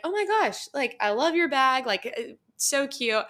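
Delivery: high-pitched, delighted gush; excited girl-to-girl compliment, settling by the end. Retold or imitated. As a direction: gush over a stranger's bag. Retells gushing over stranger's bag.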